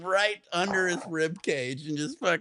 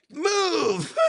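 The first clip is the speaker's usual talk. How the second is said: mock yell; 10 semitones higher than usual. Yelling at kids on Skywalk.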